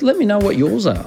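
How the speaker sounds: usual speaking voice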